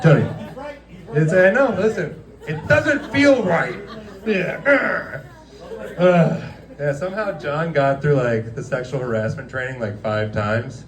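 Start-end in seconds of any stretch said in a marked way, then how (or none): none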